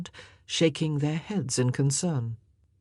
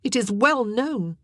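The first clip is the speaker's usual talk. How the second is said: higher-pitched, disdainful statement; knowing disgust, voice raised in pitch; 7 semitones higher, 4 dB louder than usual. A narrator's reading. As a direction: state it with open distaste. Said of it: "said in a tone of disgust".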